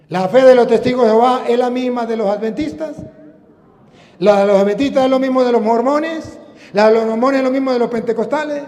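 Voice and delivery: quick list building to ringing cries; fervent preaching. Acted. Preacher challenging rival sects' faith, fervent preaching. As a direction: rattle off the list, then proclaim loudly.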